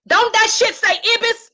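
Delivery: can't believe what you're reading; real disbelief at the drawing; astonished. Real, not acted. Discovering the bird's name live.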